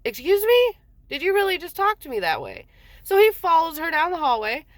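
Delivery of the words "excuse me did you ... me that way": high, indignant retold challenge. Husband confronting his daughter in the hallway, indignant. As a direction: act out an affronted parent demanding an answer.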